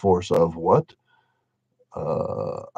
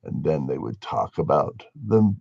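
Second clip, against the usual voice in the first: ordinary talk, nothing marked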